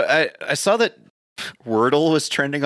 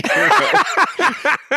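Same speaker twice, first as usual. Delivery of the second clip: laughing; 13 semitones higher, 4 dB louder than usual. Pretend. Laughing mock tribute.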